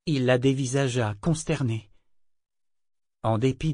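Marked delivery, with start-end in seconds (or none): none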